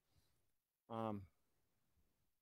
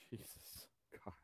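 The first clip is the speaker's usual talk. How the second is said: breathy exasperated whisper; 21 dB quieter than usual. Real, not acted. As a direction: whisper it in disbelief. Disgust at Circuit City store.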